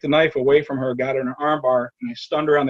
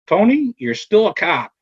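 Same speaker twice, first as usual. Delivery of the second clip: "Tony" raised high and loud, then "you're still a cop" said slowly and firmly; slow, raised, forceful delivery of "Tony, you're still a cop"; emphatic reassurance; 6 semitones higher, 5 dB louder, slower than usual. Retold or imitated. Fellow officer's firm reassurance, quoted.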